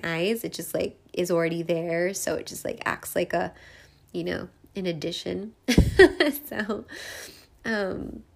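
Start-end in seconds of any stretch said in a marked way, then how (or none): 5.6-6.4 high bright laugh burst; burst of laughter. Real, not acted. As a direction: laugh out at herself. Laughs at herself.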